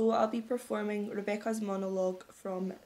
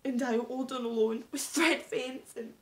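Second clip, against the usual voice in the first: melodramatic despair swelling to a drawn-out cry; despairing wail; 2 semitones higher, 9 dB louder, slower than usual. Acted. Despairing climax of acted monologue.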